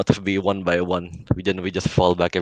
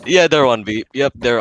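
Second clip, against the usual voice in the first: loud, sharp warning; urgent callout; 5 semitones higher, 6 dB louder than usual. Real, not acted. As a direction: shout the enemy's position.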